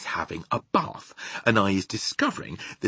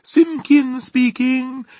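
slow, high, loud self-important greeting; pompous drawn-out phone manner; important telephone voice; 9 dB louder, much slower than usual. A narrator's reading. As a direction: answer the phone very grandly. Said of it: "in his very important telephone voice".

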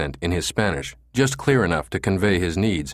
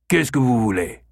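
higher, irritable French question; disturbed, scowling; 4 semitones higher than usual. A narrator's reading. Old French Canadian woken by a stranger, irritable. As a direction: ask in French, mildly annoyed. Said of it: "He scowled at having been disturbed".

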